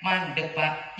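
usual speaking voice

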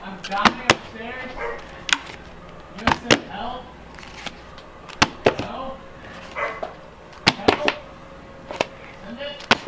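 high cries fading away; slowly collapsing. Acted. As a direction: call for help weaker and weaker.

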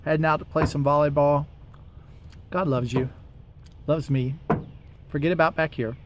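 ordinary talk, nothing marked

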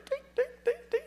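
very high, dainty dings; comic high-pitched hoof imitation; tiny hoof noises. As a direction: squeak out tiny trotting steps. Imitates little donkey's steps.

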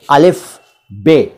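अलिफ बे drawn out, each letter named clearly; slow teacher's letter recital; slow letter demo. Shown as a demonstration. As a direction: spell out अलिफ बे slowly for learners. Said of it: "अलिफ के बाद बे".

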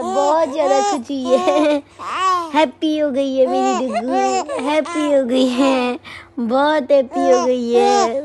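loud, delighted gushing with laughter. Real, not acted. Owner gushing at her happy dog, loud and laughing. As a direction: gush over the happy pet, laughing.

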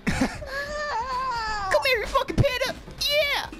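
high screaming taunt; hyped yell. Real, not acted. Yelling while attacking in game.

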